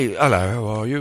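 lightly mocking British greeting; polite hello with a hint of British; British accent. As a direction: put on a mild British accent. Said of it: "British people".

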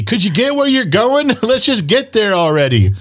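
high-pitched nagging complaint, no real volume; high, impatient mock yell. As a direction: voice aching hips griping at their owner. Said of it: "my hips are yelling at me".

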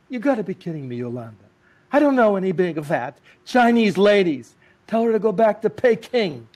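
loud, high exasperated dismissal; dismissive husband. Retold or imitated. Tony brushing off his wife's news, loud and exasperated. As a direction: wave her off in disbelief.

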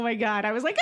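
usual speaking voice

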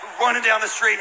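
ordinary delivery

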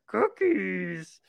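high, laughing monster voice; Cookie Monster. Pretend. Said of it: "That's a good impression".

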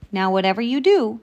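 firm mother's instruction; stern warning. A narrator's reading. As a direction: lay down the rules to a child. Mother warns her daughter.

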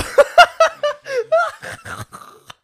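squealing, wheezing laugh; helpless high laugh. Real, not acted. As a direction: lose it completely, high and breathless.

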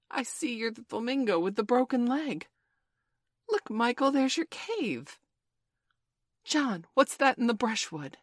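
high, loud, eager exclamations; excited. A narrator's reading. Children pointing out cave and flamingo, excited. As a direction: call out each new discovery with rising excitement. Children hailing the island's sights.

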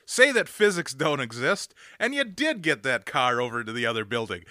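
loud, high sarcastic rant at the filmmakers; angry rant. Retold or imitated. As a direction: berate them mockingly. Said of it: "I was so angry".

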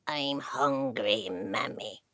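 very low, slow creature voice; low slow changeling growl. Acted.

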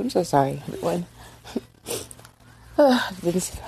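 real, choked-up apology trailing off into a sigh; fighting back tears while apologizing; tearful apology. Real, not acted. Mother apologizes to viewers while crying. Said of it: "get that emotional".

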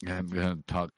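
talking through a mouthful of banana; mouth full. Real, not acted. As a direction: chew the words, mouth full. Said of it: "I have a full mouth of banana".

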